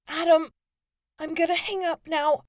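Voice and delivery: high, strained wheeze, short of air; wheezing. A narrator's reading. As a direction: force the words out through a tight chest. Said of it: "she wheezed".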